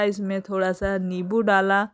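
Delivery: ordinary talk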